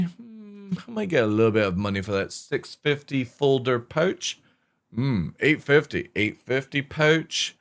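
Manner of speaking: mock dismissive miner. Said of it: "C I G are like".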